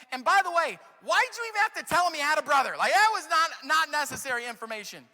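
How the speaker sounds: loud, high, fast exasperation; exasperated complaint. Acted. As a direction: whine at the sons for oversharing. Preacher mocks Jacob's complaint.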